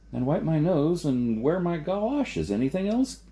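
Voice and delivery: mock the fussy advice; sarcastic retort. A narrator's reading. Jason mocking Bruco's advice, high sarcastic. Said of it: "Jason asked".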